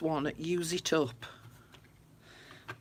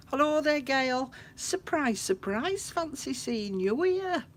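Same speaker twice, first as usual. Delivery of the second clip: much higher, louder, delighted hello; excited greeting; 9 semitones higher, 5 dB louder than usual. Real, not acted. Greets viewer Gail arriving.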